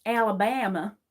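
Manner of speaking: Southern "Alabama" with a stretched stressed vowel; Southern American accent. Acted. Trying the old Southern act, Southern American accent.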